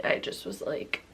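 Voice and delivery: low, breaking off into a whisper; fading whisper. Real, not acted. Remembering that night, fading to a whisper. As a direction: let the words trail to nothing. Trailing off emotionally.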